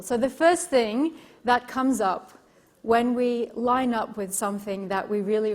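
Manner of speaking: normal talking voice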